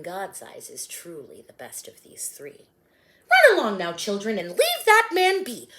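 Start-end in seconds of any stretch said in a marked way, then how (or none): 3.3-5.7 shout. Acted. Shooing children away from teacher.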